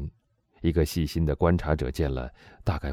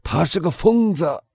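much higher, louder outcry; loud declaration; 13 semitones higher, 4 dB louder than usual. A narrator's reading. Boville loudly telling the Englishman the priest was mad. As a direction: warden loudly dismissing the priest. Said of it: "波维利先生大声说".

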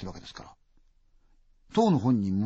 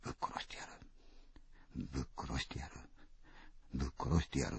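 unvoiced whisper, low and soft; creepy whispered murder threat; 10 semitones lower, 13 dB quieter than usual. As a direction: hiss it quietly to yourself. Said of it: "小さな声で".

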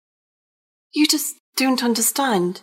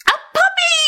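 excited squeal. Acted. Pigeon bursts out its wish.

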